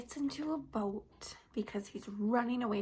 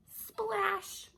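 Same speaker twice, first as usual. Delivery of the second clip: high, bright exclaimed splash; excited splash; 9 semitones higher than usual. Acted. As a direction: make the splash sound big. Sound of Jonah hitting water.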